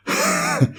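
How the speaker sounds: high-pitched burst of laughter; real high laugh, breaking off mid-sentence. Real, not acted. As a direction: crack up in a high squeaky laugh. Cracks up reading comment on accent.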